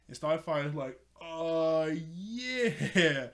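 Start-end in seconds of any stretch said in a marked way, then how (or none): 1.2-3.3 hammed-up unsure agreement, louder and stretched; stalling fib. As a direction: imitate someone inventing an excuse on the spot. Starfire making up an excuse.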